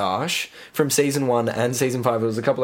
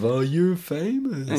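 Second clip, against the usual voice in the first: starstruck gush; 5 semitones higher, much slower than usual. Retold or imitated.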